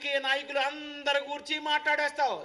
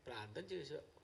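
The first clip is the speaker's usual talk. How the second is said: usual speaking voice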